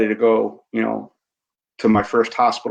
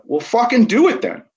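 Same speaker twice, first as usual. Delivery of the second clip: blunt friend; 12 semitones higher than usual. Retold or imitated. Retold blunt veteran friend's push.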